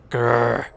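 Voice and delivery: loud, frustrated "grr"; mock growl. Gamer cursing the mana cap, mock growl. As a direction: growl it out in annoyance.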